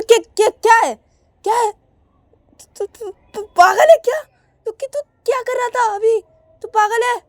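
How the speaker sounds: high, loud, stammering angry yell; furious sister shouting. Acted. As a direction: shout at him in outrage. Shruti shouts at Rahul.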